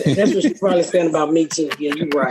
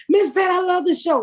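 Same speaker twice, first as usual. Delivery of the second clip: polite fan; 9 semitones higher than usual. Retold or imitated.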